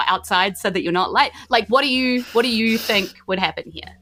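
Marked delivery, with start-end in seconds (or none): none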